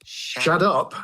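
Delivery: "Shut up" as one word, shaddap; slangy pronunciation. Shown as a demonstration. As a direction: pitch "Shut up" up and soften the T to D. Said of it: "S H A double D U P".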